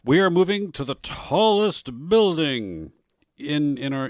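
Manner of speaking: high, loud, stretched-out proclamation; mock-grand announcement. Telling friend about the office move, mock-grand. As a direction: proclaim it slowly with pretend grandeur.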